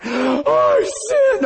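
very high wailed confession; crying. Retold or imitated. Man reenacting his confession at the urinal, crying. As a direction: wail it as an exaggerated sob. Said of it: "standing there at the urinal crying".

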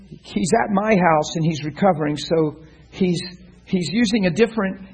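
ordinary talk, nothing marked

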